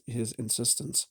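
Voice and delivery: usual speaking voice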